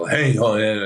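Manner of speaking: lightly hammed-up overacting; a hint of a bad actor emoting; mild mock over-the-top delivery; bad acting. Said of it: "bad acting".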